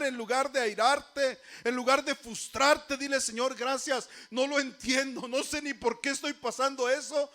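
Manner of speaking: loud, driving preaching. Real, not acted. Preacher urging congregation to thank God in trouble, fervent preaching. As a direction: pile up phrases with fervent force.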